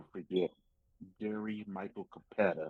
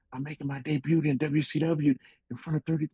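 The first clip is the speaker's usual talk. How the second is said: loud, higher-pitched bragging news; excited announcement; 8 semitones higher, 12 dB louder than usual. Retold or imitated. Wrestler phoning his buddy about the debut, excited. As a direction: blurt out the big news to a pal.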